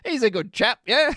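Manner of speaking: high, loud mock English chap. Streamer joking about a card name, playful English chap voice. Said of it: "badly spelled English chap".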